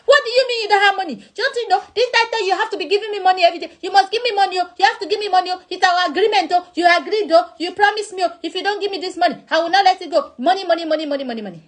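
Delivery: high, fast mock rant; hammed-up demanding tirade; nagging wife. Wife voiced angry money demand.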